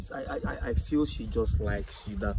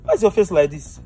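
concerned loud question; 9 semitones higher, 10 dB louder than usual. Acted. Father sees upset daughter.

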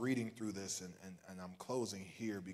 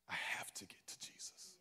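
whispered desperate repeat; urgent whisper; 2 semitones lower, 5 dB quieter than usual. Acted. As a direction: whisper it like a man straining toward Jesus. Voicing the man's desperate thought.

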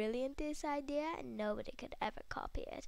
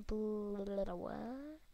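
normal talking voice

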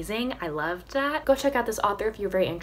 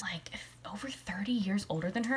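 whisper; 8 dB quieter than usual. Real, not acted. Gossipy aside about age gap.

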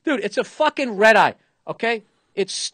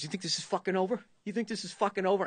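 imitating a seatmate's quiet menace; mock menacing threat; 9 dB quieter, faster than usual. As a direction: lean in and needle him. Angry passenger threatens seated comedian.

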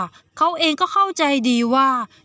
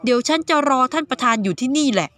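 ordinary delivery, nothing marked

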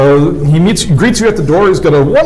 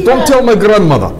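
put-on Italian accent; 7 semitones higher than usual. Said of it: "He had a mood swing".

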